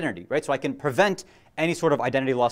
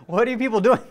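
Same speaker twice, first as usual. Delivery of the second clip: higher, louder mock exasperation; playful exasperation; 6 semitones higher, 4 dB louder than usual. Real, not acted. Preacher teasing the audience for their silence, mock exasperated. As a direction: tease them, pretend you can't believe it.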